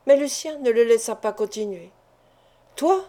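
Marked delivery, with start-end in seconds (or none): 2.8-3.0 s'exclamer, la voix qui monte; exclamation. A narrator's reading. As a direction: question lancée comme un cri contenu. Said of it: "s'écria-t-il". (cri interrogatif, légèrement joué)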